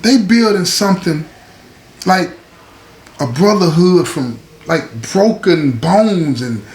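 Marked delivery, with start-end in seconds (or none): none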